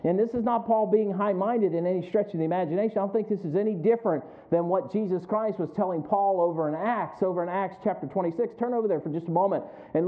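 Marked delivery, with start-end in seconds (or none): none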